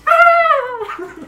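sharp high shriek of shock; a shrill cry of alarm; woman's shocked gasp. Retold or imitated. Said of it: "One woman goes".

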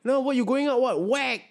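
high, bossy policeman voice; mock angry cop. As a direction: play the cop who scolds and hits. Acting police scolding someone outside.

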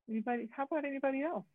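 ordinary delivery

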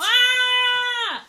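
high, loud startled yelp; sudden shriek. Real, not acted. Startled yelp on nearly stabbing her own foot. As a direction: shriek as something jabs your foot.

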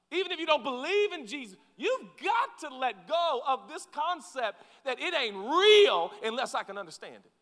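normal talking voice

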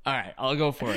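ordinary delivery

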